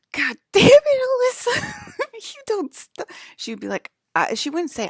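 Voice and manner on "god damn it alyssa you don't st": high, loud exasperated yell; mock furious friend scolding; angry Becca impression. Imitating angry Becca to her friend, high shrill yell. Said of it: "Becca would be like".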